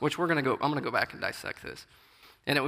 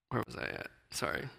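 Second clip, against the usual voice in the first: whispered to himself; hushed self-directed aside; muttered aside; 2 semitones lower, 10 dB quieter than usual. Real, not acted. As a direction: drop to a whisper, lost his place. Losing his place mid-sermon.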